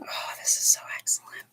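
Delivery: breathless delighted whisper; real thrilled hush; excited whisper. Real, not acted. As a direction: whisper your delight at the card. Delighted reaction to drawn card.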